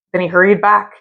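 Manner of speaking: usual speaking voice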